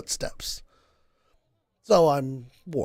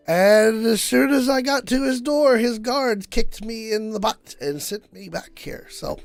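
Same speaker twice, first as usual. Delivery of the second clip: higher, louder tipsy innkeeper voice, a couple of drinks in; tipsy Volo; 7 semitones higher, 3 dB louder than usual. Acted. Volo tells the party his news, high and tipsy. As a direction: play a jolly half-drunk Volo. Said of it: "you sound a little sick".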